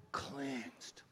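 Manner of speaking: a hushed whisper on the final word; whispered reveal of the healing. Real, not acted. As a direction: drop to a breath to land the miracle. Climax of the healing story.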